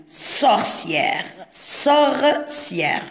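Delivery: "Sorcière" in two stretched syllables; sounding out. Shown as a demonstration. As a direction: draw out "Sorcière" so each sound is heard. Teacher sounds out word for pupils. ("Sorcière" مقطع بمقطع وبمد)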